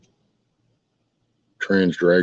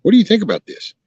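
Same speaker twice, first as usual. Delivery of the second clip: mock interviewer; 13 semitones higher, 4 dB louder, faster than usual. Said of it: "asked the people".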